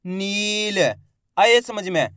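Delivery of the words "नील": "नील" with a stretched ee, closing on l; blended long-vowel word. Shown as a demonstration. Teacher joining the letters, "नील" drawn out. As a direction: hold the ee in "नील", end on a bare l. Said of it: "तो मिलके बन जाएगा".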